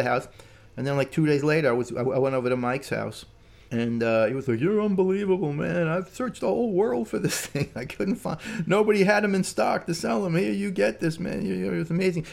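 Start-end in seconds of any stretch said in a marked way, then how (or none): 4.6-12.3 high, excited gushing, imitated; worked-up high-voiced disbelief and thanks; amazed gratitude. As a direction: imitate an overjoyed friend, pitched well up. Acting out Mike's amazed thanks.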